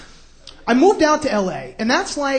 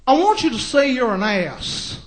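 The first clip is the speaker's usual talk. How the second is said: slow mocking TV-host impression; Doctor Phil. TV host humiliating a guest, slow mock impression. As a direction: stretch it out like a TV advice guru. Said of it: "he's like".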